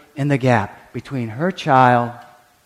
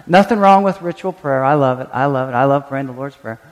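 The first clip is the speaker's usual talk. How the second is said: ordinary talk, nothing marked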